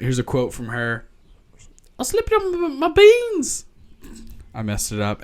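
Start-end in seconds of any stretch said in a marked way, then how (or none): 2.0-3.7 put-on high, childlike Aussie voice; a little kid's Australian, pitched way up; Bingo's Aussie kid voice; attempted Australian accent. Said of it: "I can't do an Australian accent".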